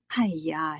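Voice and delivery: playfully chiding exclamation; mock scolding. A narrator's reading. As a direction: pretend to be appalled at a child. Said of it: "in a mock scolding voice".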